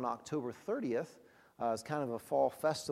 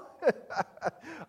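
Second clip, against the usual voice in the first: high rising burst of laughter; real laugh; 12 semitones higher, 4 dB louder than usual. Real, not acted. Preacher laughing at the volunteer's panic, real laugh. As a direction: laugh out loud at the memory. Laughing at his own story.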